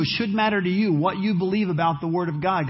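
ordinary talk, nothing marked

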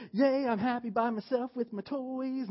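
high, lightly voiced little girl; a hint of a chirpy child playing alone; playful child voice, lightly done; mock little girl. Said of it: "she wasn't just like".

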